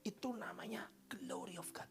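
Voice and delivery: soft hushed whisper. Real, not acted. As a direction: whisper it with awe. Whispered awe.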